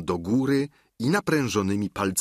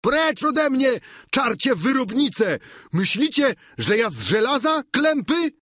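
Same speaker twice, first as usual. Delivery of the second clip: high, furious full-force shout; enraged, high-pitched loud yelling; Sancho screaming; 4 dB louder than usual. A narrator's reading. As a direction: scream at your tormentors with all your might. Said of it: "krzycząc z całych sił".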